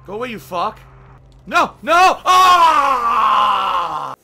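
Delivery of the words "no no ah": high loud yells into a long scream; real frustrated scream; despairing shout. Real, not acted. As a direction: scream as it all goes wrong. Failing in the game.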